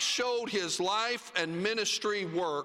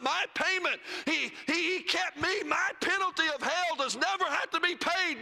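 full-force preaching; 6 semitones higher than usual. Acted. Preacher crying out the gospel to his church, raised and ringing.

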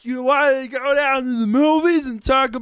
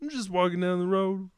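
low, quiet, quick Batman voice; Kermit the Frog voice; 4 semitones lower, 6 dB quieter than usual. Acted. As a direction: toss it off low and hushed. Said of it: "I'm the same as Kermit the Frog voice".

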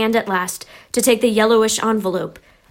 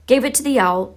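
plain reading voice

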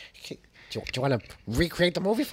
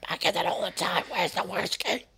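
usual speaking voice

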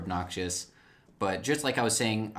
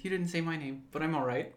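higher, fussy droid voice; C-3PO impression; 8 semitones higher than usual. Second C-3PO try for a co-host. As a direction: play the polite droid primly. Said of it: "he's like".

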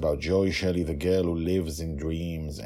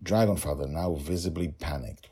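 usual speaking voice

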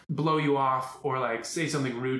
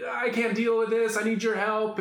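higher, pleading to the ex; stressed plea; 8 semitones higher, 4 dB louder than usual. Retold or imitated. Father asking son's mom for help, stressed plea. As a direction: beg for help, a touch frantic.

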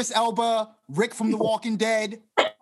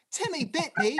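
badly faked British accent, pitched up; a higher, poor attempt at British; 7 semitones higher, 4 dB quieter than usual. Shown as a demonstration. Host trying a line for the guest, bad British accent. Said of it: "I can't even tell you how bad that was".

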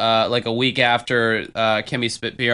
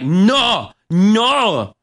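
loud, high mock-French no; Kimmy; 8 semitones higher, 5 dB louder than usual. Drunk woman thinking this is French, loud mocking imitation. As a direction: imitate a drunk faking French, drawing out each no. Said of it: "she thought saying no was, like, how you say".

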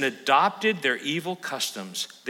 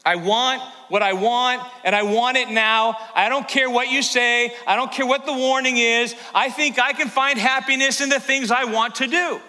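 put-on spoilt little kid; 7 semitones higher than usual. Preacher mocking God's people as a demanding child. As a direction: whine it like a kid who wants it now. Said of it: "They're just like little kids".

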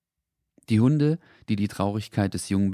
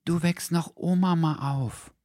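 slow, higher, softly worried; quiet worried voice; 10 semitones higher, slower than usual. A narrator's reading. Voice warning the dog about grandma, slow and soft. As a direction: warn the dog gently not to wake grandma. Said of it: "sprach eine leise Stimme plötzlich besorgt".

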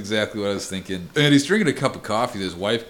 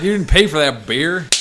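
higher, louder acted rebuke; mock scolding; 6 semitones higher, 6 dB louder than usual. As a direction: tell him off in jest. Acts out character smashing a beer.

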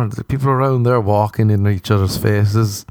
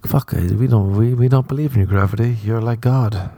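usual speaking voice

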